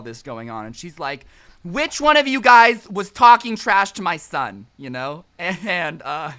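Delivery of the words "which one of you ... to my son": loud, high furious mother; angry mom. Retold or imitated. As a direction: demand it like a mother defending her son. Angry mom confronts party.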